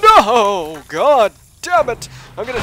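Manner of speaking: loud, slow, high howl of frustration; frustrated cursing. Real, not acted. Player cursing the bugs, frustrated. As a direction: howl the curse, drawn out.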